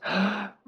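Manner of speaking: sharp surprised gasp; a quick startled intake of breath. Acted. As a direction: catch your breath in surprise. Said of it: "looks over and goes".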